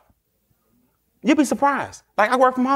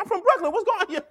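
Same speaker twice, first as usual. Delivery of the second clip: higher, swaggering mock tough talk; 9 semitones higher, 4 dB quieter than usual. Man claiming Brooklyn to look bigger, higher swagger. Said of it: "You start claiming where you from".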